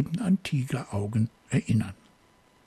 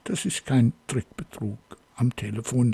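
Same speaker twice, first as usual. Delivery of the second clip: usual speaking voice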